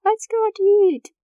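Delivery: high, loud, bright mom voice; mom's cheery suggestion. Retold or imitated. Mom cheering up her sad daughter, high and loud. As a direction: imitate mom perking up with an idea. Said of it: "she would just be like".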